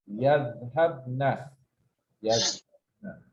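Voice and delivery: yadhhabna stretched out slowly; slow model word. Shown as a demonstration. Teacher stretching yadhhabna for the class, slow. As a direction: draw out yadhhabna syllable by syllable. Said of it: "drawn-out model word".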